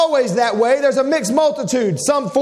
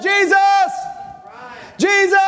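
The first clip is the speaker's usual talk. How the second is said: short, raised call of the name; higher call, held back from screaming; ordinary calling out; 8 semitones higher than usual. Shown as a demonstration. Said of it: "This wasn't just".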